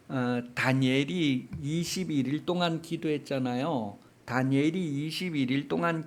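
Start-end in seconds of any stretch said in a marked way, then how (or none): none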